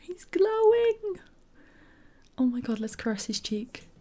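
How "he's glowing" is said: very high, drawn-out coo of wonder; amazed squeal. Real, not acted. Player marvelling at the glowing character, very high squeal. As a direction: squeal it slowly, right up high.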